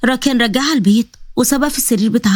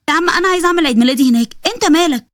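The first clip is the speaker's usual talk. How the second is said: indignant; 6 semitones higher than usual. Acted. Acted quarrel over birthday venue.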